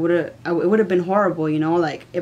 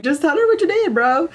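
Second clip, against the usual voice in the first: much higher, pushy, fed-up retort; exasperated snap; 11 semitones higher, 3 dB louder than usual. Retold or imitated. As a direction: shove the blame back on him. She snaps at boyfriend.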